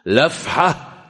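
لفحة loud and emphatic; lafha punched. Shown as a demonstration. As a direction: hit لفحة with force. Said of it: "مش تنفح".

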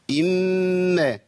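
"إما" with a long nasal hum on the mim; doubled mim. Shown as a demonstration. Teacher isolating the first shadda, hummed mim. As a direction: hold the mim of "إما" slowly, then release. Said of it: "فشددت الميم".

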